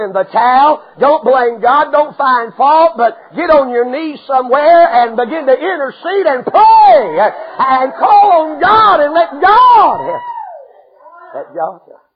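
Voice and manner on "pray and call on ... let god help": loud, slow, high preaching cry; preaching shout. Real, not acted. Preacher urging the church to pray, shouting slowly. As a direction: belt it out to the congregation. Preacher exhorting at full force.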